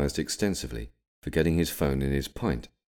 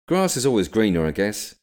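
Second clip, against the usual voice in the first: mildly awkward remark, raised voice; 5 semitones higher, 6 dB louder than usual. A narrator's reading. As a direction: offer it awkwardly, a little higher. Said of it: "he said awkwardly".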